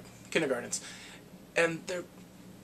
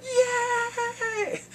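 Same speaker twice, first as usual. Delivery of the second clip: very high, stretched cheer; hysterical kids; 3 dB louder than usual. Retold or imitated. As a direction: imitate kids cheering wildly. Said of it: "the kids are going hysterical".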